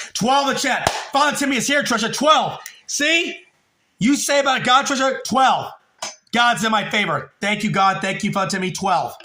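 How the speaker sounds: high-pitched, elated outburst; real excitement. Real, not acted. Streamer thanking God for twelve in chat, excited. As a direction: celebrate a big win, voice jumping up.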